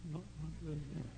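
a hint of a sulky boy. Boy promising the preacher, a hint of sulky. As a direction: mumble it like the boy.

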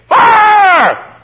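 full-voice high scream, held long; screamed alarm. Acted. Man spotting a house on fire, screaming. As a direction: scream it out with urgency. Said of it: "He screams".

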